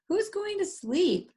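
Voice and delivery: thrill at staying up all night; excited Max. Acted. Max telling Froggy they'll stay up, excited.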